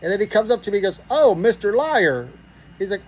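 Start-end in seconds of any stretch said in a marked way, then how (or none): 1.1-2.3 drawn-out, higher mock greeting; mocking taunt. Cop comes up to the searched driver, mocking. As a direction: imitate a cop needling a suspect, slowly.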